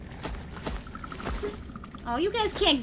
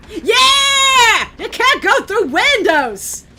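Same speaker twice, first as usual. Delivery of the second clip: triumphant shout; 6 semitones higher, 8 dB louder, slower than usual. Real, not acted. Gloating at enemies.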